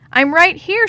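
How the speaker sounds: high, loud answering call; yelling back. A narrator's reading. Lost girl answers friends searching for her, yelling back. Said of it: "she yelled back".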